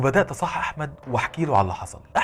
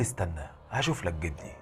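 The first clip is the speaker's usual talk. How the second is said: low, soft, unbothered reply; detached and unhurried to help; cool indifference; 8 semitones lower, 7 dB quieter than usual. A narrator's reading. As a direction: answer coolly, voice dropped low. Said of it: "بيتكلم ببرود".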